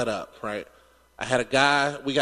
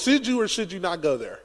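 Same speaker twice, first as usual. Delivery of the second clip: ordinary talk, nothing marked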